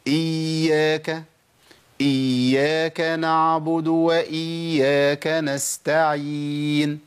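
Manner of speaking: slow recited "إياك" with a held, doubled ya; correcte tajwid-uitspraak. Shown as a demonstration. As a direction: dwell on the ya in "إياك", chanting evenly. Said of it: "يبقى أقولها إزاي؟".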